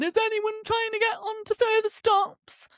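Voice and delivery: high, drawn-out platform call; railway worker calling out. Retold or imitated. Railway worker asking passengers about further stops, mock calling out. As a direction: sing it out slowly to the whole platform.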